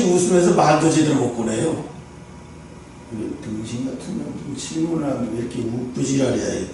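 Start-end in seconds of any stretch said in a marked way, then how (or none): 3.1-6.7 low, hushed grumble of disbelief; muttered inner retort. Retold or imitated. Retelling a silent reaction to the lawyer's smirk, muttered. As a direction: grumble a private insult under your breath.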